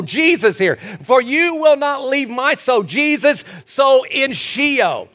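high, impassioned pulpit shouting; full-force preaching. Real, not acted. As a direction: drive each phrase home to the congregation.

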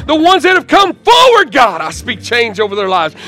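high, loud preaching prayer; fervent prayer. Real, not acted. Pastor praying over those who came forward, fervent shouting. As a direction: cry out to God at full force.

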